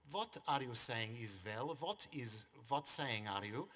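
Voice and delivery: lightly put-on foreign accent, slow and puzzled; father teasing the mum, mild mock Hungarian accent; fake broken English. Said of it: "He pretended to this woman that he couldn't speak any English".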